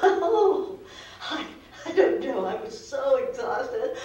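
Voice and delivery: laughing through the words, exasperated; helpless laugh. Acted. Wife laughing helplessly to the counsellor about the flooding. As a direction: laugh it off, worn out.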